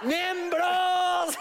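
high shout to the crowd; shouted call. Real, not acted. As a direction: yell it out to the audience. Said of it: "host calling out". (grito agudo al público)

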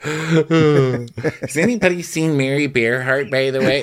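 drawn-out pretend search call; mock announcement. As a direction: play someone paging a missing person. Continues French Open bit.

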